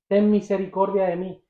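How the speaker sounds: ordinary talk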